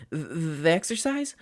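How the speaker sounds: high, slow nervous stammer; stammering boy. A narrator's reading. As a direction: stumble over it, anxious. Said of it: "the boy stammered".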